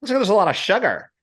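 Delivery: high-pitched caricature of a politician; mock politician voice, high; Ron DeSantis. Shown as a demonstration. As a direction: shake your head as you push it high. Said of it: "you gotta shake your head".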